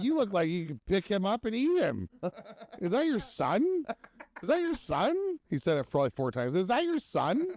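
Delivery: high, laughing teasing voice; mock teasing guy. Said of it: "He's like".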